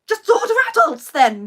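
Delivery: loud exasperated order; impatient snap. Retold or imitated. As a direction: cut him off, just do it. Snapping at husband on phone.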